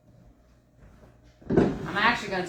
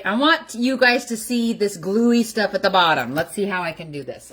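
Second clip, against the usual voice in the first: usual speaking voice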